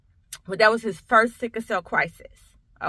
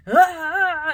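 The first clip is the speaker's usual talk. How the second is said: high, mock baby wail; imitated baby cry; 8 semitones higher, 6 dB louder than usual. Mother mimics her crying two-month-old, imitated wail. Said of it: "he just crying".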